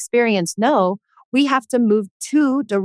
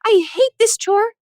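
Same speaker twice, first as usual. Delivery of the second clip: high-pitched mock whining teenager; hammed-up complaining kid, much higher voice; whiny teen. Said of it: "people will come be like".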